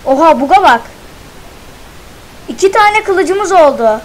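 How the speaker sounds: loud, high amazed cry; amazed excitement. Real, not acted. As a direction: wow at the new sword. Amazed at new sword.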